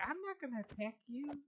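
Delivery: high squeaky mock-angry creature; mad mob voice. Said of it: "he got all mad and was like".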